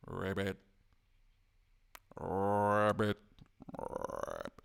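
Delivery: low, fading croaked "Rabbit" sinking to a whisper; frog croak. Retold or imitated. The frog refusing to perform, a low croak.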